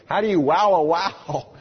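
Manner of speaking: baffled listeners. Retold or imitated. Seminary listeners trying to understand the scholar, mock bafflement. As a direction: puzzle loudly over "wow a wow", playing it up. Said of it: "several of us are sitting there thinking".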